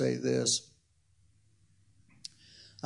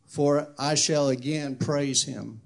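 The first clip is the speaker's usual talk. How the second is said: scripture reading; 5 dB louder than usual. Acted. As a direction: declaim the psalm, letting phrase ends settle. Reads scripture aloud.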